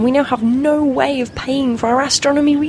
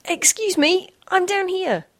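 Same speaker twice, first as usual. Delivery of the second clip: small squeaky voice; 7 semitones higher than usual. Acted. Tiny Meg calls up.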